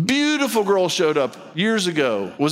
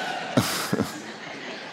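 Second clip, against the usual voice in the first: quiet laugh; 8 dB quieter than usual. Real, not acted. Laugh after joke.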